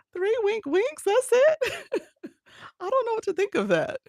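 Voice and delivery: high squeaky playful disbelief with giggles; playfully indignant at TikTok in a high voice; mock outrage. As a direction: squeal like you've been cheated, half laughing. Mock outrage at TikTok's three gifts.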